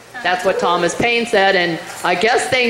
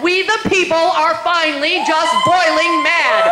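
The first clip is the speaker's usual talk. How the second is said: building shout; 7 semitones higher than usual. Real, not acted. Building rally climax.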